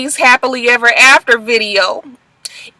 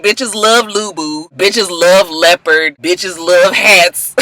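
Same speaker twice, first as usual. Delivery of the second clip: slow, lower, lightly voiced boyfriend; imagined Lorenzo; 5 semitones lower, slower than usual. Reality star bragging to himself, slow and low. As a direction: drawl it out like a smitten man. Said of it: "I guess he was like".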